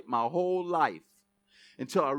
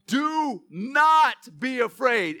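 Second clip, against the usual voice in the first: "Do not be afraid" stretched out slowly, each word weighted; slow emphatic; 10 semitones higher, 10 dB louder, much slower than usual. Shown as a demonstration. Repeated slowly.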